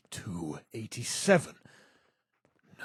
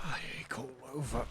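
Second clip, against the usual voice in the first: pained whisper; 6 dB quieter, faster than usual. Acted. Character waking up.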